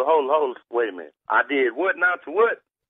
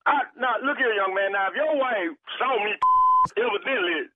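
much higher, heated pushback; rising anger; 12 semitones higher than usual. Real, not acted. Repairman snapping back at the caller over his wife. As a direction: defend yourself, getting worked up.

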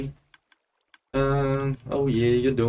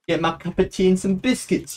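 high-pitched, lightly mock British voice; put-on British accent; 7 semitones higher than usual. Streamer turns British for a bit, mock British accent. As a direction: ham up a little British accent. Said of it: "turn British for a little bit".

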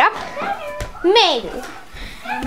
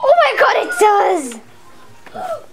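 gasping, thrilled and higher; delighted gasp; 7 semitones higher, much slower than usual. Real, not acted. Girl thrilled to see the Christmas lights glow, gasping. Lights come on, she gasps.

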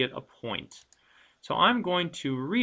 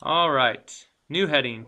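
normal talking voice

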